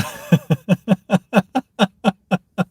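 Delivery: long, high, helpless laugh; real laughing fit that keeps rolling; uncontrollable laughter. Real, not acted. Streamer unable to carry on with the show, laughing helplessly. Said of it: "I can't stop laughing right now".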